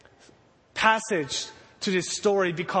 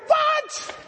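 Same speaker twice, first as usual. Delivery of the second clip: shocked shout. Retold or imitated. Disciple shrieking in disbelief at the news.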